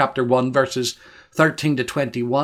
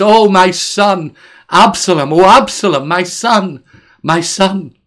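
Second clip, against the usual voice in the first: slow, louder and higher anguished cry; 4 semitones higher, 8 dB louder than usual. Acted. Preacher reads David crying aloud for Absalom, anguished loud cry. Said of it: "and the king cried with a loud voice".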